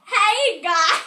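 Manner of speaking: loud, exaggerated vlogger greeting; mock YouTube greeting, punched loud; attempted YouTuber impression. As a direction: belt out a put-on channel intro. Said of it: "I used to be able to do this".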